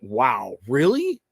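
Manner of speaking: mock-surprised wow, really, pitch jumping up; retold gasp of disbelief, rising. Retelling the moment he saw the wrap-up, surprised. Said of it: "I just went".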